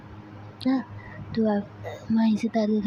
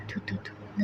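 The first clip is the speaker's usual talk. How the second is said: soft unvoiced "thoo thoo thoo" spits; ritual spitting; 9 dB quieter, much slower than usual. Anjali wards off the evil eye from the couple with soft spits. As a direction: puff out three little spits against the evil eye.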